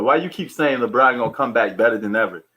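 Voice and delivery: ordinary delivery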